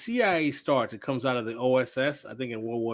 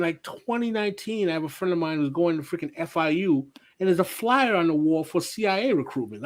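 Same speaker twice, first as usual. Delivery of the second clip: usual speaking voice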